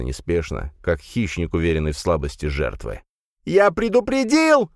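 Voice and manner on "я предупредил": very high, loud, slow squeal; shriek. A narrator's reading. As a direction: shriek it in terror. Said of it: "взвизгнул парень".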